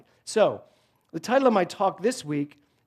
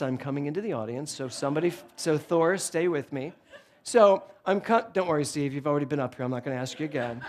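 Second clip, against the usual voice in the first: usual speaking voice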